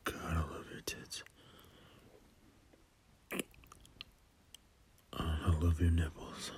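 breathy intimate whisper, very soft; sensual whisper. Acted. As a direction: whisper it close to the ear.

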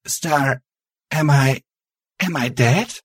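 high, halting character voice; hesitant realization. A narrator's reading. Ghost asking Star if she is dead, faltering. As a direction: falter before the dreaded question.

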